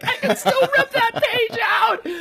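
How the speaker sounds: high, laughing mock panic. Author clinging to her page, laughing panic. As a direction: laugh it out, half-desperate.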